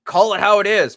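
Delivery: a lightly voiced stubborn purist; mock purist. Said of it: "one of those purists that's like".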